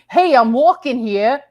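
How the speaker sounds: New York accent. Shown as a demonstration. Visitor to the city at a taxi, New York accent. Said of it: "That was a good New York accent".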